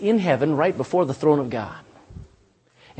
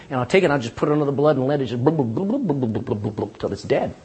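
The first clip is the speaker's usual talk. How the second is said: fading blub; 3 semitones lower, faster than usual. Shown as a demonstration. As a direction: let the bubbling die away into a hush. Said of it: "I'll just take the thought".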